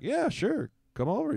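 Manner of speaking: high-pitched eager yes; sure, come over, thrilled; mock eager. Father retelling eager reply to son, pitched high.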